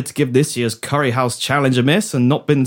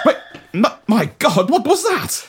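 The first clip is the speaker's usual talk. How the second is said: high, alarmed cry of shock; startled alarm; 8 semitones higher than usual. Acted. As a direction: jump at the sudden noise, voice shooting up. Hears a scream in the lavatory.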